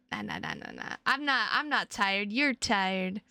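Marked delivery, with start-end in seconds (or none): 0.1-1.0 low growled nahs. Pretend.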